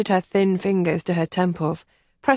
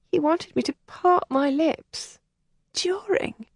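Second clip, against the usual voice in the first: put-on higher, distressed young woman's voice; a sheltered girl close to tears; upset young lady; 10 semitones higher than usual. Helen confides in Kathleen, high and distressed.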